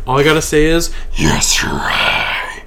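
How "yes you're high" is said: slow, low whisper. Pretend. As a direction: breathe it out slowly. Deadpan answer to imagined listener.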